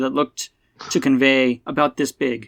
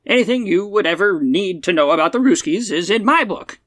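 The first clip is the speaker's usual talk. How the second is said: put-on high-pitched old-time radio pitchman; old-man radio personality; 6 semitones higher than usual. Old man plugs his book to Buck, high old-man radio voice. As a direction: plug it like an elderly twenties broadcaster. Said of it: "nineteen twenties radio personality with the old man accent".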